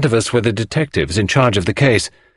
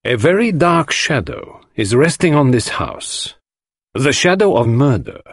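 Poirot, grave and theatrical; 6 semitones higher than usual. Acted. Poirot tells the husband of murder, gravely. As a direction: warn with dramatic weight.

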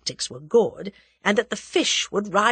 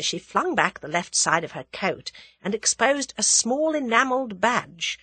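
usual speaking voice

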